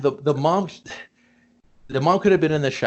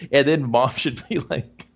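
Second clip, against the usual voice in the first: normal talking voice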